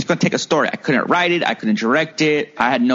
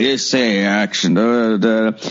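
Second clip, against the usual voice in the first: lower, slow, slurred mock of a dazed actor; mumbling Ron Jeremy; 7 semitones lower, slower than usual. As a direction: slur it like someone half out of it. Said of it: "He's just like".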